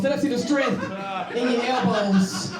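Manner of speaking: drawn-out joking elbows line; slow mock emphasis. Shown as a demonstration. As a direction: stretch the words out for the joke. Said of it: "Let them see the strength in your arms".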